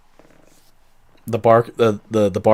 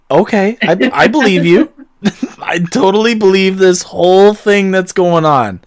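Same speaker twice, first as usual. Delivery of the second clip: sarcastic agreement; 7 semitones higher, 4 dB louder than usual. Acted. Barkeep humors the odd customer.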